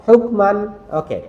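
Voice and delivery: ordinary talk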